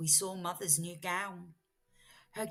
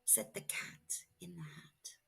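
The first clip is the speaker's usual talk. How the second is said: low soft whisper; very soft low whispered tag; 13 semitones lower, 10 dB quieter than usual. A narrator's reading. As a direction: drop to a hushed whisper.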